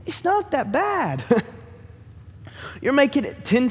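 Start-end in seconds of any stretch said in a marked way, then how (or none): 0.0-1.2 higher, slow, unbothered answer; lightly voiced calm God; God's calm reply. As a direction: gently brush off the drama as God. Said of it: "And God's like".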